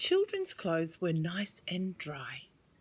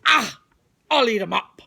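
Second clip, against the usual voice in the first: louder, hungry shark voice; greedy shark; 11 dB louder than usual. Acted. Shark eyeing Tim's legs, loud and greedy. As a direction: relish the meal. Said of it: "he thought".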